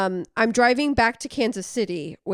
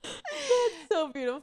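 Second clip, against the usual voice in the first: softer, slow pretend crying; mock tearful; 6 semitones higher, 4 dB quieter than usual. Friend mock-weeping over the story's happy ending, slow and tearful. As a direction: pretend to be moved to tears.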